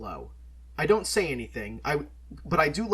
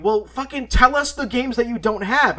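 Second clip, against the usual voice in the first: higher and louder, mock exasperated demand; mock demanding shout; 10 semitones higher, 9 dB louder than usual. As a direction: throw it at him like an impatient friend. Friends demanding, retold quote.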